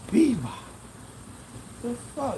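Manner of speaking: high, disbelieving "Bebop, the fuck?"; a stunned squeak of disbelief; incredulous. Retold or imitated. Streamer replaying his reaction to Bebop's insult, high and incredulous. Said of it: "I'm like".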